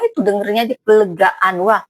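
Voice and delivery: ordinary talk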